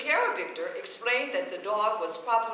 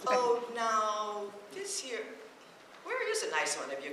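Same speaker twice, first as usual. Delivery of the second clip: ordinary delivery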